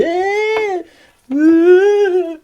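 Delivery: long, high, loud "aah"; acted storm cry. Storyteller acting disciples tossed in storm, loud drawn-out cry.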